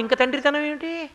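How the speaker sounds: ordinary delivery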